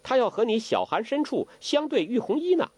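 put-on high, coy young girl; sly teasing girl. Huang Rong teases Zhou Botong, coy.

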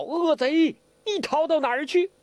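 high, loud shout of accusation; loud cry. Acted. Yinggu at the villain fleeing the boat, shouting. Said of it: "嘴里大叫".

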